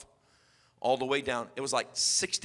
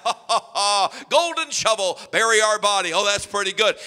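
mocking laughter; 3 semitones higher, 7 dB louder than usual. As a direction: laugh at the threat as a scoffing kid. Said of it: "They laugh".

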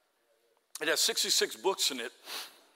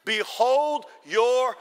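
slow, raised proclamation, word by word; congregational lead-in; 5 semitones higher, 7 dB louder, much slower than usual. Shown as a demonstration. Preacher has everybody say three words together, slow loud proclamation. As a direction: lead the crowd to say it along with you. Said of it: "everybody say it with me".

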